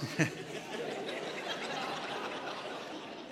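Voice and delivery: high airless giggle; breaking up again, wheezing; wheezing laugh. Real, not acted. Preacher still cracking up at the joke, breathless laugh.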